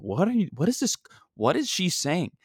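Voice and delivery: high-pitched baffled disbelief; retold bewildered question. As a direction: react as if you can't believe what you're hearing. Acted baffled reaction to stranger.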